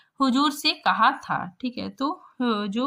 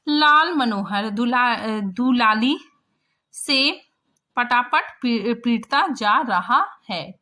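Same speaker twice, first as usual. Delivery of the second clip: slow, louder reading of the passage; story reading; 2 semitones higher, 5 dB louder, slower than usual. A narrator's reading. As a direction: read the fight scene out to the class. Reads the story text aloud. (धीमा कहानी पाठ)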